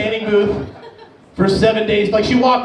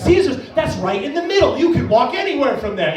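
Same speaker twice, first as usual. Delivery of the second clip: higher-pitched exaggerated enthusiasm; super expressive; 4 semitones higher than usual. Worker giving a tourist directions, super expressive. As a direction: oversell it to a foreign visitor. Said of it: "you have to be, like, super expressive".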